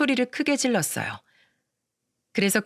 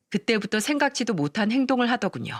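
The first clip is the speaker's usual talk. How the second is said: ordinary delivery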